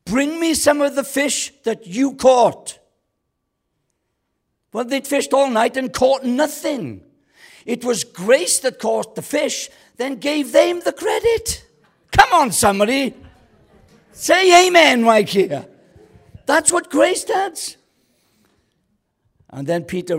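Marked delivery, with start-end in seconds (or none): 0.0-2.8 loud, high preacher's shout; big, drawn-out shout across the water. Acted. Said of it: "grace shouts to them". 4.7-17.8 high, shouted preaching, drawn out; loud, soaring revival-preacher cry; full-force preaching. Real, not acted.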